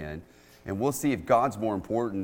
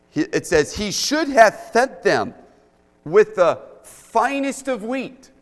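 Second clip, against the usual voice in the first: raised, fervent preaching voice, higher and louder; 10 semitones higher, 10 dB louder than usual. Acted. Pastor preaching to the church on big faith, fervent. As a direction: preach it with conviction to the congregation.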